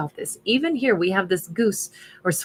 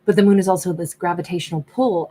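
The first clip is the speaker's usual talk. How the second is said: ordinary talk, nothing marked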